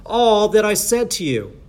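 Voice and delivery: slow, high, loud scripture proclamation; drawn-out, forceful verse reading; emphatic preaching. Real, not acted. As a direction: proclaim each word to the congregation.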